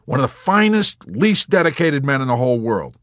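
high, loud, effusive; extravagant praise. Acted. Yossarian extravagantly praising Dunbar.

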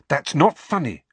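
a quick sharp rebuke. A narrator's reading. Hermione snaps at Harry's joke, sharp. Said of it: "said Hermione sharply".

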